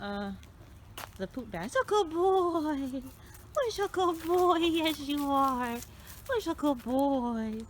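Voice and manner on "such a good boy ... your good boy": much higher, slow, drawn-out dog praise; squeaky praising a dog. Real, not acted. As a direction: coo slowly at the dog.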